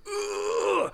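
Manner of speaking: high, strained soft groan; rising blood pressure. Retold or imitated. Imitating blood pressure rising in traffic, high strained groan. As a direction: squeeze out a pressure-cooker squeal. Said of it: "your blood pressure is just like".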